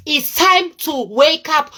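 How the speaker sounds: drawn-out wake-up call, each word weighted; slow emphatic warning. Real, not acted. Vlogger warns viewers to stop overspending, slow and emphatic.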